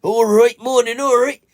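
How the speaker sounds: put-on high Norfolk accent that slips Welsh; a shaky attempt at Norfolk, high. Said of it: "how do you do a Norfolk accent".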